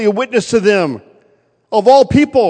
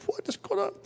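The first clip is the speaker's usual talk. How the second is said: whispered baffled thought, much softer; inner whisper; 15 dB quieter than usual. Retold or imitated. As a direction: breathe it to yourself, puzzled.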